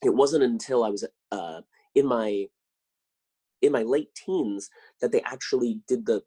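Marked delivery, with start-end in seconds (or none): none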